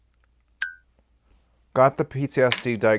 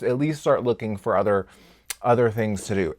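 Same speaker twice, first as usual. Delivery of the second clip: normal talking voice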